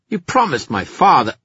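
protest, cut off mid-line; outraged protest. Acted. Erec protesting under threat.

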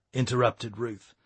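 ordinary delivery

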